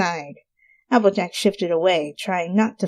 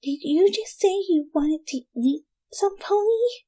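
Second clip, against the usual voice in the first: high, soft hesitant question; timid Fluttershy; 12 semitones higher, 3 dB quieter than usual. Acted. Fluttershy timidly asks if the spell worked. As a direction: ask it shyly, almost afraid to.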